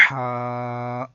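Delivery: ہا from mid-throat, held long and steady; careful throaty haa. Shown as a demonstration. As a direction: sustain ہا evenly with a strong throat h. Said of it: "احتیاط سے پڑھنا ہوگا". (ہا حلق سے، لمبا کر کے)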